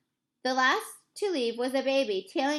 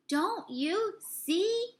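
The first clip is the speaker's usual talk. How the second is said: very slow, much higher, drawn out; exasperated repeat; 8 semitones higher, much slower than usual. A narrator's reading. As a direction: beg them to finally understand. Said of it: "he repeated".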